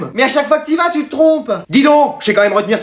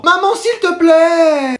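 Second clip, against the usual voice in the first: high, very drawn-out plea; pleading; 6 semitones higher, much slower than usual. Acted. Child begs mother for McDo, pleading. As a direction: whine for it, stretching the words.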